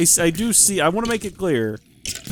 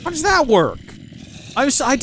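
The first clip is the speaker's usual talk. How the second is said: baffled; 11 semitones higher, 3 dB louder than usual. Real, not acted. Player baffled by a crafting recipe, high and incredulous. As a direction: jump high and loud in disbelief.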